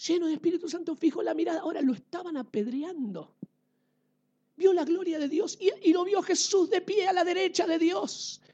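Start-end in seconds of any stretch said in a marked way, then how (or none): none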